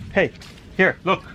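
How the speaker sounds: normal talking voice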